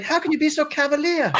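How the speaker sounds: high indignant British lilt; British wife. Retold or imitated. Wife scolding husband, high mock British indignation. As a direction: play the appalled wife. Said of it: "she was like".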